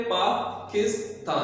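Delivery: Pakistan slowly, in three separate beats; syllable by syllable. Shown as a demonstration. Teacher counting syllables in Pakistan, slowly divided. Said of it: "इसमें कितने syllable है".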